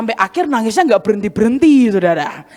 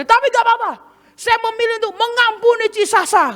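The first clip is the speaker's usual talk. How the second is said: high, self-righteous forgiving tone; imitating a pious forgiving girl; mock magnanimous; 9 semitones higher than usual. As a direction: play the martyr granting pardon.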